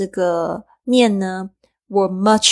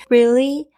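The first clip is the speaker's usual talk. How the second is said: Really? with a sharp rising question tune; 5 semitones higher, 4 dB louder than usual. Shown as a demonstration. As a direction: lift the pitch up at the end of Really?. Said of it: "注意一下语调上扬".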